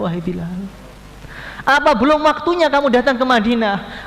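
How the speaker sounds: high, loud pleading questions; the Prophet pleading with Bilal; longing reproach. Acted. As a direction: plead with him to come back. The Prophet asks why Bilal stays away.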